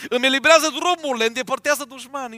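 loud, high joyful exclaiming; thrilled believer shouting praise; excited praise. Pretend. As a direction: burst out praising God's wonders. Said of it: "N-ai fi spus așa?".

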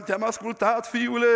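ordinary talk

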